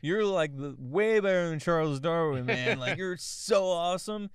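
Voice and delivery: put-on high, loud drunken gushing; hammed-up tipsy hero worship. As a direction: gush like a drunk admirer. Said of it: "He's drunk".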